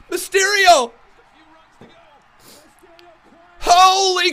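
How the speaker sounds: high, loud screaming play-by-play; frantic hype commentary. Real, not acted. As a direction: yell every move like a crazed fan. Screaming live commentary over the match.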